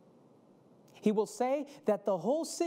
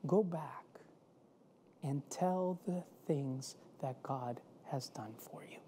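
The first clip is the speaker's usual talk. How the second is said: quiet command; 7 semitones lower, 6 dB quieter than usual. Acted. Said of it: "and tells him".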